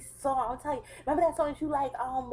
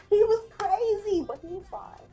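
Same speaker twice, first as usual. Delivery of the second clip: exasperated exclaim; 11 semitones higher, 8 dB louder, slower than usual. Real, not acted. Recalling the wild night.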